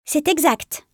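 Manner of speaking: polite. A narrator's reading. New apprentice confirming to the veteran he got his chance, politely.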